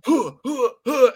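high strained grunting, hammed up; mock straining grunts. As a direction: grunt like you're forcing a rock to move. Mocking actors straining at earthbending.

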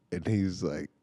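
ordinary delivery

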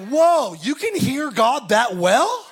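a believer amazed at how close God can be; astonished wonder. Retold or imitated. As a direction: stretch each question out in disbelief. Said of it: "It's almost like".

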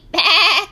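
high, loud quavering bleat; short wobbly "baa"; sheep bleat. Acted. Sheep bleat inside the narration.

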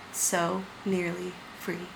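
faint, slow dying breath; slow, soft and fading; weakly choked out. Acted. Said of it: "weakly choked out".

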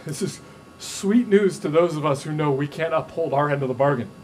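usual speaking voice

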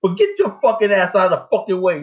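ordinary talk, nothing marked

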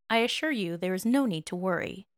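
plain reading voice

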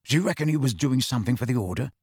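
ordinary delivery

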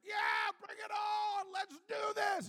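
high, drawn-out hyped-up yell. Retold or imitated. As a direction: imitate a kid yelling with bravado at full volume. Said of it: "like, yelling and".

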